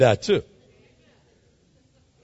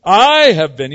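a hint of a sulky kid, louder; 9 dB louder, slower than usual. Elijah in pity party mode answering God, lightly childish. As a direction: whine it like a sulking child. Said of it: "kinda like a kid".

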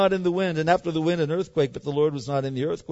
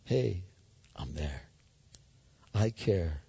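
very soft and much lower, tender; still small voice; 8 semitones lower, 12 dB quieter, much slower than usual. Pretend. God reassuring someone at their lowest, soft and low. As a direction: hush it like a quiet reassurance. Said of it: "still, small voice".

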